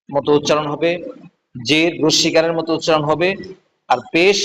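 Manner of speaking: ordinary delivery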